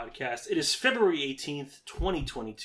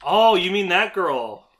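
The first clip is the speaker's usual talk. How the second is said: loud, high, drawn-out aha; sudden realization; 6 semitones higher, 11 dB louder, much slower than usual. Real, not acted. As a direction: realize it loudly and slowly.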